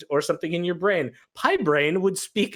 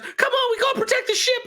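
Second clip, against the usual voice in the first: high, fast rallying call; rallying shout; 3 dB louder, faster than usual. Acted. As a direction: urge the crew on, high and quick. GM voices NPC rallying crew.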